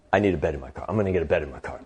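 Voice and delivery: low, soft self-talk; brooding to himself. Acted. Envious driver talking to himself in car, brooding.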